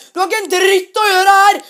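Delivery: "Du har ikke en dritt å gjøre her!" bellowed loud and high; full-volume version of the rebuke; real-volume shout. Shown as a demonstration. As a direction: yell "dritt" full force like the father did. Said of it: "jeg kan si det han sa på ekte".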